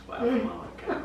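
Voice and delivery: high, giggly pet talk. Real, not acted. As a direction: laugh while coaxing the dog. Coaxing the dog, laughing.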